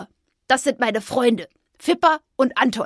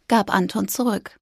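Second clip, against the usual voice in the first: ordinary delivery, nothing marked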